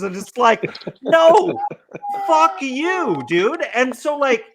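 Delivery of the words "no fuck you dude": mock angry retort. Answering the smug buyers online, high angry mock retort. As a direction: throw it right back at them.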